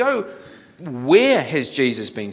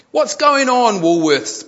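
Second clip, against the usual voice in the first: loud, high panicked customer; mock panic; 11 semitones higher, 10 dB louder, slower than usual. A narrator's reading. Customer panics on Facebook at Woolworths shutdown, loud mock panic. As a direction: cry out in mock alarm. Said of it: "panicked another".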